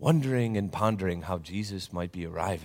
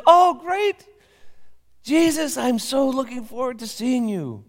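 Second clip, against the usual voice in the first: high, loud, overjoyed voice; delighted old Papa; 8 dB louder than usual. Acted. Papa answering Jesus in the dream, high and overjoyed. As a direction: gush with delight.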